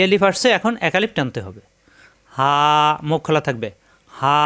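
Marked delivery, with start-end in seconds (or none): 2.4-2.5 হা with the a held long, one alif; long drawn vowel. Shown as a demonstration. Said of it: "এই যে আওয়াজটাকে একটু লম্বা করলাম". 4.1-4.4 হা held out long; long drawn vowel. Shown as a demonstration. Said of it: "এই যে আওয়াজটাকে একটু লম্বা করলাম".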